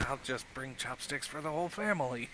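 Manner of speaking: quick, quiet aside; maid's hushed grumble to herself; under her breath. Acted. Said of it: "hear her under her breath".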